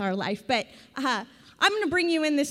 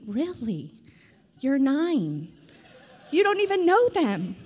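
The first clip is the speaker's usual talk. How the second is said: amused disbelief; 3 semitones higher, slower than usual. Retold or imitated. Mom answers her nine-year-old daughter with laughing disbelief. Mom's incredulous reply to child.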